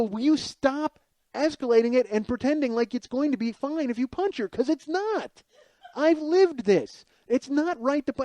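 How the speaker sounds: frantic, rising exasperation, trying to talk her down; angry pleading. Retold or imitated. To his girlfriend about punching a stranger, frantic high exasperation. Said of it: "I'm getting more angry and more inadequate".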